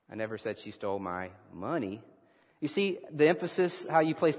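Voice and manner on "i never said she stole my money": "money" set apart and emphasized at the end; stress on money. Shown as a demonstration. Preacher practicing emphasis with the congregation, stress on "money". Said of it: "depending on the emphasis that you place".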